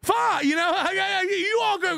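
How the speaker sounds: ordinary talk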